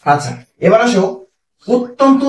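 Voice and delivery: ordinary talk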